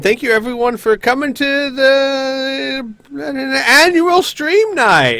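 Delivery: announcer voice. Acted. Host opening stream in announcer voice.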